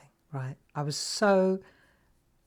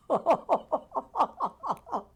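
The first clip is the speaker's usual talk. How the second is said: high, helpless laugh; real burst of laughter; 11 semitones higher than usual. Real, not acted. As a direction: break into a high giggle. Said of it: "Try not to laugh".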